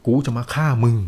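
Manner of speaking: understated menacing threat, a touch loud. A narrator's reading. Ghost chief confronts the dreamer, mildly loud threat. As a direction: threaten him with a little extra force. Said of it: "แล้วกล่าวเสียงดัง".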